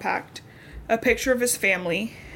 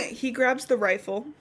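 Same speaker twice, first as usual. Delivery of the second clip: usual speaking voice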